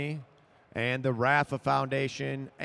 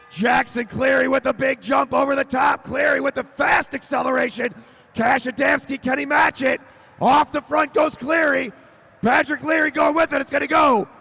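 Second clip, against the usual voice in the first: loud, higher shouting of the sprint; excited shouted finish; 9 semitones higher, 8 dB louder than usual. Real, not acted. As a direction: scream the break off the front.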